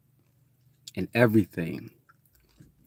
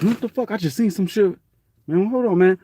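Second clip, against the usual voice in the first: higher, startled gasp of words; mock spooked; 11 semitones higher, 4 dB louder than usual. Someone who just saw a ghost, higher and spooked.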